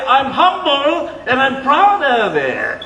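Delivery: slow, smug mock boast; slowed-down sarcastic boast; mock proud boast. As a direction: drawl it like a self-satisfied braggart. Mocking a proud claim.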